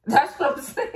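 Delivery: high, laughing agreement; real laughter lifting the words. Real, not acted. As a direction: crack up while saying it. Cracking up at her own outburst.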